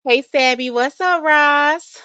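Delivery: ordinary talk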